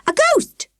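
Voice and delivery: high, alarmed cry; frightened shriek. A narrator's reading. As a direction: cry it out terrified. Girl shrieks at ghost.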